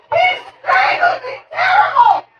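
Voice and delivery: put-on high-pitched frantic kid voice; kid crying out. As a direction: squeal it like a child tattling mid-fight. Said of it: "And this kid goes".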